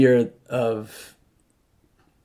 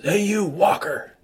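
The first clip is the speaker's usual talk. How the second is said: higher, slow call from the roadside; hostile local calling out; 8 semitones higher, slower than usual. Retold or imitated. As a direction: hail the walker like a suspicious local. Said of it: "He goes".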